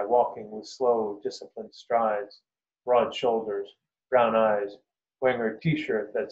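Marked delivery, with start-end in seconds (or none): none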